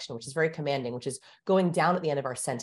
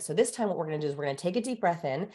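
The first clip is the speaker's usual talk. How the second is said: usual speaking voice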